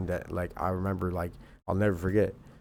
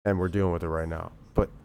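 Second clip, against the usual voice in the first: normal talking voice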